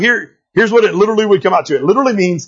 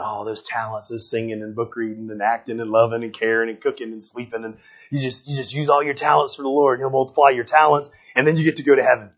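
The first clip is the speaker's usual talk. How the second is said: put-on softer, dreamy, sing-song take on his old view; mock his own sappy misreading; mocking naive reading; 7 semitones lower, 6 dB quieter than usual. Preacher mocking how he used to read the verse, softer and dreamy. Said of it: "I've taken that thing and I said".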